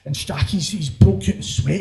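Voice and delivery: usual speaking voice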